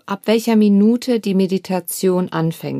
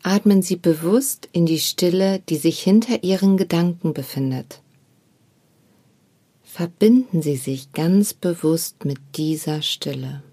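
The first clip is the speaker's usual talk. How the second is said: guided meditation. Acted. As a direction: guide the listener gently into stillness. Leads a meditation.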